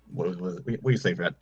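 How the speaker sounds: ordinary delivery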